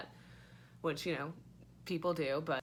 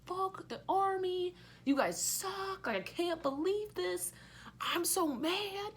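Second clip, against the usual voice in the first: much higher, slow, hammed-up outrage; imitating the women pilots fuming at the Army; mock angry girls; 12 semitones higher, slower than usual. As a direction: play the furious pilots as a high cartoon rant. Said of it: "there's chicks who are, like".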